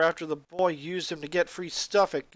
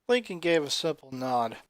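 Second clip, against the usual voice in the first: ordinary delivery, nothing marked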